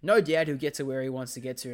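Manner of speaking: ordinary delivery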